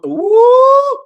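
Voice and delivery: high, rising drawn-out "woo"; excited whoop. Real, not acted. Teacher struck by a new verse, excited whoop. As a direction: whoop as the verse hits you.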